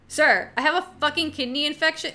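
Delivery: sick passenger yelling at the cab driver, fed up; exasperated yell. Retold or imitated. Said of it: "I didn't say it like".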